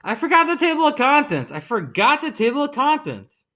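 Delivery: high, flustered exclamation; crying out. A narrator's reading. Narrator realizing his mistake mid-story, crying out. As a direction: cry out in a fluster, pitched well up. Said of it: "cried Jack the narrator".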